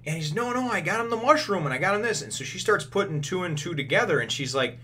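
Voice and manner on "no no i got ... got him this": much higher-pitched, excitable insistence; retold insistent protest. Chopper insisting the doctor was cured, high-pitched protest. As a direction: protest eagerly in a raised, high voice.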